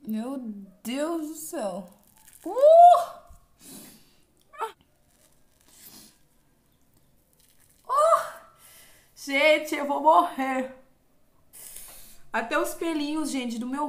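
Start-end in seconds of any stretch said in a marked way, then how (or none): 2.4-8.3 very high loud yelp; a sharp cry at each tug; pained yelp. Real, not acted. Pulling the mask off, sharp pained yelps. Painful peel. 9.1-10.8 louder, half-laughing protest; dramatic complaint. Real, not acted. To her viewers mid-peel, loud dramatic complaint. Pain of peeling.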